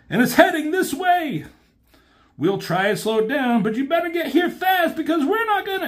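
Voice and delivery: high, fast shouted warning; high, fast cartoon alarm; frantic warning. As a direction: rush the warning before the signal dies. Warning before the line cuts out.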